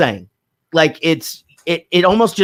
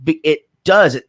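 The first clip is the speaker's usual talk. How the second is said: usual speaking voice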